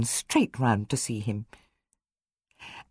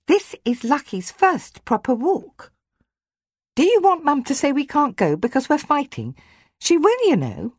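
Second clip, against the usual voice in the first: put-on girl's voice; 12 semitones higher, 3 dB louder than usual. Georgia scolding her brother.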